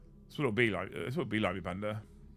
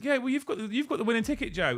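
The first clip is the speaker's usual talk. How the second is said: high, pushy insistence; mock-insist he won; exasperated insisting; 10 semitones higher than usual. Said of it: "I was like".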